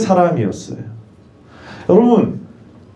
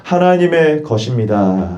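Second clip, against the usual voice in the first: slow, lowered, measured call; lead the repeat; 6 semitones lower than usual. Real, not acted. Preacher has congregation say it after him, slow and low. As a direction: draw it out evenly for others to echo.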